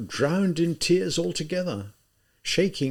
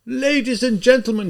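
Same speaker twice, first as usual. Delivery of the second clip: higher, louder address to the room; crying out; 9 semitones higher, 4 dB louder than usual. Acted. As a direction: call the crowd to attention. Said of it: "he cried".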